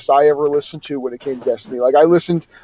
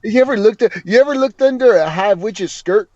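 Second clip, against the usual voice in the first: Drifter voice; 5 semitones higher than usual. Retold or imitated. Riffing on the Drifter voice.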